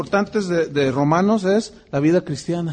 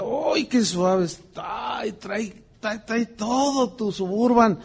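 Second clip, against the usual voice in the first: slow, drawn-out admiring voice; slow mock praise; 2 semitones higher, 5 dB quieter, slower than usual. Admiring the missionary's new Suburban, slow mock praise. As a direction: savour each word of praise.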